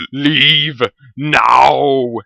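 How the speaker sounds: loud, drawn-out menacing order; commanding roar. Acted. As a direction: bellow it slowly to scare them off. Villain scares goblins away.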